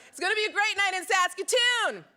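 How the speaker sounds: excited. Real, not acted. Hyping up the Saskatoon crowd.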